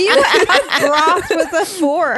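real laughing incredulity; laughing disbelief. Real, not acted. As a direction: stretch it out laughing.

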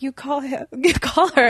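laughing through the words; giggling while urging. Retold or imitated. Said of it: "I was like".

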